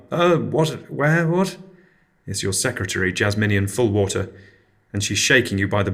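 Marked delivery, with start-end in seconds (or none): 0.1-1.6 startled awake. Acted. Startled waking from dream.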